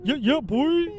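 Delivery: a high, loud, put-on hype-man voice. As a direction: bounce "Yeah, yeah, boy" like a DJ hype man. Hype-man call repeated.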